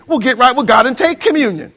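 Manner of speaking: ordinary talk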